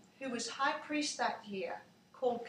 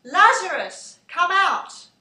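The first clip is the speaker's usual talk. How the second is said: loud call; 6 semitones higher, 17 dB louder, slower than usual. A narrator's reading. Jesus calling into the tomb to dead Lazarus, full shout. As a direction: shout it out, higher and much louder. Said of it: "said in a loud voice".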